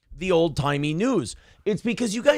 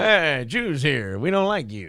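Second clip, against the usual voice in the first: exaggerated cartoon intonation; 4 semitones lower than usual. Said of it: "She's doing an impression of the guy".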